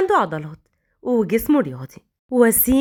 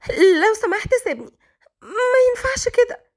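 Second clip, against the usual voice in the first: higher, crying plea to be let go; tearful plea; 10 semitones higher than usual. A narrator's reading. Malak pleading with Raad to let her go, crying and high. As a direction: beg through tears. Frightened maid pleads to be released.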